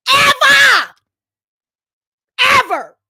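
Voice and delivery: high, loud scream of outrage; explosive angry yell; furious shout. Real, not acted. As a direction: scream the word out. Furious emphasis in rant.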